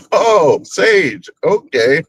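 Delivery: high, swooping "oh, I see"; mock realisation. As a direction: act out amused, dawning understanding. Acted sudden realisation on hearing sage.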